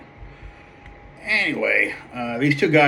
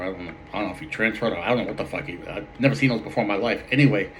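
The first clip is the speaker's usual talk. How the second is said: ordinary talk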